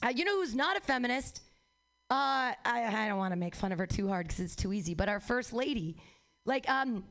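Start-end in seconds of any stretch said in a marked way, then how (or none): none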